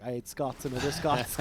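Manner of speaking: lightly put-on Scottish Willie voice; Scottish accent. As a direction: growl it like a grumpy Scot. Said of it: "groundskeeper Willie from The Simpsons".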